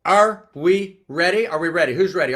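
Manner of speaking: loud, rising pep-rally call to the crowd; rallying cheer. Acted. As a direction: whip the room up like a cheerleader. Rallying the audience to answer.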